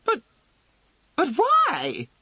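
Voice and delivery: high, loud, stammered question; incredulous. Acted. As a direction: burst out in utter disbelief. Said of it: "as though she could not believe her ears".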